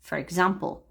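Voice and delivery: normal talking voice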